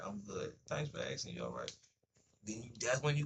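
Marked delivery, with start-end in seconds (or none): 0.0-1.7 deep, easy "I'm good" answer; low polite reply. Acted. The other person answering a friendly greeting, low and polite.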